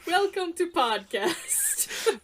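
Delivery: high, drawn-out silly voice; goofy, stretched-out mock welcome; mock announcer. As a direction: tease with a slow, high put-on greeting. Co-host mocks the botched intro.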